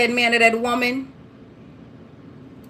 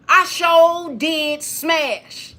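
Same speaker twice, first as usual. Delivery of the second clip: drawn-out slip; 3 semitones higher, much slower than usual. Shown as a demonstration. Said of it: "what Holy Spirit had me say".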